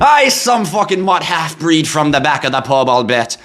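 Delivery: high, muddled Irish-Scottish brogue; hammed-up Irish pub brawler, pitched high; Irish or Scottish accent. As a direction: put on a high, shaky-origin Celtic accent, part Irish, part Scottish. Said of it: "is that Irish or is that Scottish".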